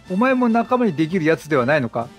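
usual speaking voice

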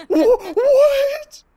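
high, loud stunned "what"; shocked disbelief. Real, not acted. Player shocked by a glitching game, high loud disbelief. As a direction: blurt it out in total disbelief.

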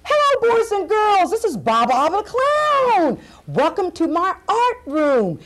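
put-on high, bubbly children's-show clown voice; excited clown host. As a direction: greet the kids with big clownish cheer.

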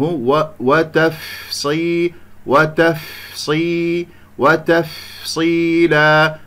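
وتفصيلا very slowly, breath running long through the ف; airy flowing fa. Shown as a demonstration. Teacher making the weak fa audible, slow وتفصيلا with long breathed ف. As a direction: let air flow on the ف of وتفصيلا. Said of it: "يحتاج إلى كمية من الهواء".

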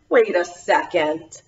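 plain reading voice

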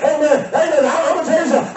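usual speaking voice

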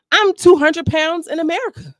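high, loud emphatic statement. Real, not acted. Vlogger explaining pounds to viewers, emphatic. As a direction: hammer the example home.

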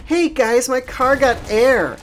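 high-pitched imitation of a carefree girl; squeaky-high mock girl voice, drawn out at the end; mock breezy girl. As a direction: imitate her blithely, way up high. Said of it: "she just kinda laughs it off and goes".

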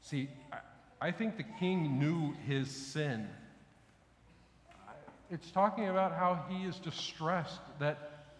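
ordinary delivery

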